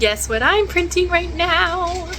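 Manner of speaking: high, loud and thrilled; bubbling over with joy; excited. Real, not acted. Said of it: "I'm so excited".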